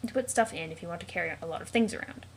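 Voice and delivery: usual speaking voice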